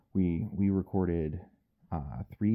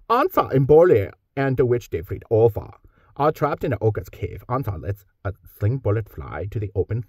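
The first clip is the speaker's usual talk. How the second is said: reading in a light Scandinavian accent, louder; 3 semitones higher, 8 dB louder than usual. Shown as a demonstration. Voice actor demoing his audiobook read in a light Scandinavian accent. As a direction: narrate the fantasy scene in a lightly Scandinavian lilt. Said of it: "my Scandinavian accent is".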